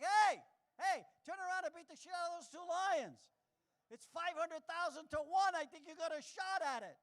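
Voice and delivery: high, loud yelling at the TV; yelling at the screen. Retold or imitated. Comic yelling at wildebeest on TV, high loud shout. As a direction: shout coaching the wildebeest to fight back. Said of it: "yelling at the screen".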